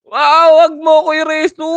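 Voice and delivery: loud, high startled yell; surprised shout. Real, not acted. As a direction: yell in shock at the play. (gulat na sigaw)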